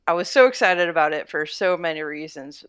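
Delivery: ordinary delivery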